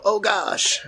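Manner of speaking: high hammed-up gasp of surprise; fake surprise. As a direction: ham up being caught off guard. Faking a stumble in character.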